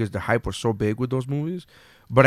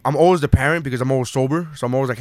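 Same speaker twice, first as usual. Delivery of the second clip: usual speaking voice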